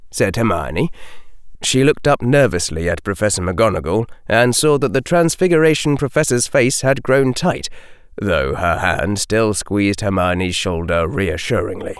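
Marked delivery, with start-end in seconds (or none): none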